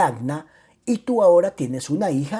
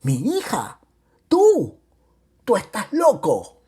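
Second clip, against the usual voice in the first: high, incredulous outcry; defiant shout; 6 semitones higher, 4 dB louder, slower than usual. A narrator's reading. Mother-in-law scorns threat to take daughter, shouting. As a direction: build to a loud, undaunted cry. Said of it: "gritó impertérrita ella".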